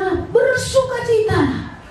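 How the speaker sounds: high, slow, ringing call to rejoice; joyful exclamation. Retold or imitated. Paul in a cold prison cell calling his readers to rejoice, a high, slow ringing exclamation. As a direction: proclaim it like a man rejoicing in chains. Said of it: "Tapi dia bilang".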